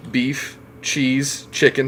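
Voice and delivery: usual speaking voice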